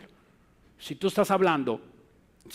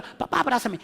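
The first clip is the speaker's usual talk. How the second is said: put-on child pleading; 7 semitones higher than usual. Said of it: "the other child".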